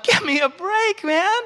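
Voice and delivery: high, drawn-out exasperated complaint; fed-up comic whine, high-pitched; exasperated outburst. Retold or imitated. Retold exasperated outburst at home.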